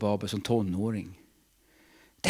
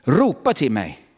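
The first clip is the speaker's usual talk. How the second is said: slow, louder command; voice of God; 2 semitones higher, 7 dB louder than usual. Acted. Preacher voicing God repeating his call, firm and slow. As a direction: speak as God insisting. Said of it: "säger Gud".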